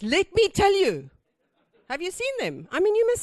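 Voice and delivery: know-it-all South African man. Retold or imitated. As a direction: lean in like the man who has every answer. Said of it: "the one that says".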